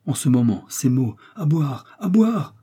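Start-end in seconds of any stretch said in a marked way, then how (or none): 1.4-2.6 much higher, slow begging cry; dying Kennedy begs Joe for a drink, heartbroken; heartrending plea. A narrator's reading. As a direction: plead desperately for water. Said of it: "prononcés avec un accent déchirant".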